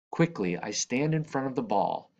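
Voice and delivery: plain reading voice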